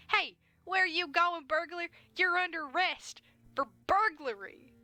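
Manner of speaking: high, loud, slow cop yell; deputy shouting at the burglar, high and loud. Acted. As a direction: bellow it like a goofy deputy. Deputy yelling at fleeing burglar.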